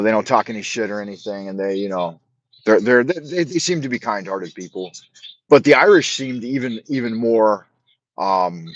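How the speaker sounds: ordinary talk, nothing marked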